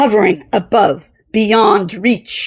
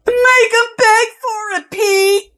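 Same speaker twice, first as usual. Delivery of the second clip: high, jeering call across the room; 11 semitones higher than usual. A narrator's reading. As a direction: egg him on, calling out. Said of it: "Tom Callahan called out".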